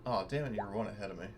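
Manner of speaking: normal talking voice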